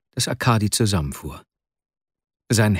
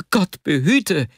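frightened cry; 8 semitones higher, slower than usual. Acted. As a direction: cry out in alarm. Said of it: "schrie Vasja auf". (erschrockener Aufschrei)

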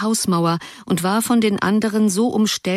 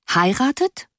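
put-on high, rising girl's echo of surprise; a touch of girlish astonishment; astonished; 7 semitones higher than usual. A narrator's reading. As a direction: echo the word in mild disbelief. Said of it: "fragte die erste Stimme erstaunt".

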